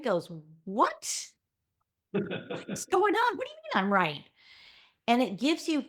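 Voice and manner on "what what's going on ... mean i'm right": high, drawn-out baffled what; voicing the listener's startled brain; confused surprise. The confused brain reacting to you're right, baffled.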